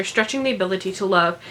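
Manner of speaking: ordinary talk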